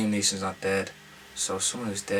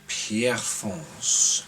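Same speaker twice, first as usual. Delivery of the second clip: Pierrefonds drawn out slowly in a French accent; much slower than usual. Shown as a demonstration. Reading out the filming question, slow French accent on Pierrefonds. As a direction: stretch out Pierrefonds the way a French speaker would. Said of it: "That's my French accent".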